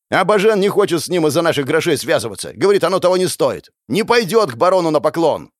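high, heated explanation; angry yell. A narrator's reading. Gang elder explains the patron's refusal, yelling. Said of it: "заорал на меня старший".

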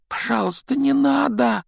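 plain reading voice

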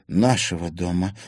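ordinary delivery, nothing marked

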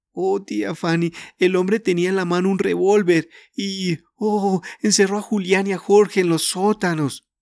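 high, upset pleading, close to sobbing; tearful pleading. A narrator's reading. Ana pleading with her uncle and aunt, tearful. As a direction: pour it all out, near tears. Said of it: "gimió".

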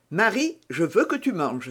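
ordinary delivery, nothing marked

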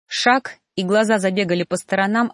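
ordinary delivery, nothing marked

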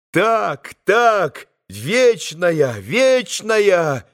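high, ringing exclamation; voiced boys calling out, high and fervent; ringing boys' cry. A narrator's reading. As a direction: cry it out like a chorus of eager boys. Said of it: "прокричали все мальчики своими звонкими голосами".

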